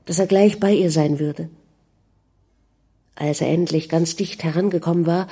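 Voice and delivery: usual speaking voice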